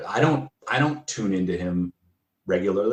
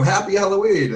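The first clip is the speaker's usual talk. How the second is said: mock cheery greeting; 12 semitones higher, 6 dB louder than usual. Fan greets painted-face Doug.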